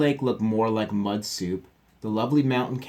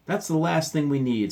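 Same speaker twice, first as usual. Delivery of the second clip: scoffing; 7 semitones higher, 5 dB louder than usual. Acted. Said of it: "scoffed Papa".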